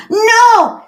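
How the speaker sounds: put-on very high, loud kid's refusal; refusing child. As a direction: cry out like a child refusing to leave. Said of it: "in five minutes, you come over".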